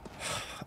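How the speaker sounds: a deep, soft exhale to let it go; long weary sigh. Real, not acted. Streamer catching breath after the fight, weary sigh. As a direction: breathe out the stress.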